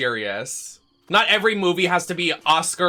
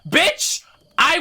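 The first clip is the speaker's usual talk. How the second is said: sassy bitch; 11 semitones higher than usual. Acted. Fan rant on movie haters.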